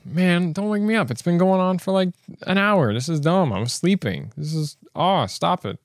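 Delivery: high-pitched, whiny imitation of annoyance; mock exasperated complaint. Vlogger woken by missile alert, mock exasperated. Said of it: "his video was super, like".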